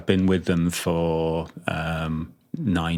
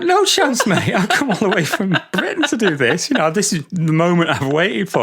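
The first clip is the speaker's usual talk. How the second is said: high-pitched mock outrage, near laughing; hammed-up refusal, starting very high and loud; indignant refusal; 10 semitones higher, 4 dB louder than usual. Refusing a drummer who wants his drum, mock indignant and amused.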